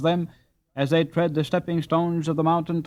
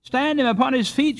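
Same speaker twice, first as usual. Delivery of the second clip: much higher shouted suggestion; a bystander shouts advice about Jacob; 12 semitones higher, 4 dB louder than usual. Acted. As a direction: yell it over the crowd. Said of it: "shouted another".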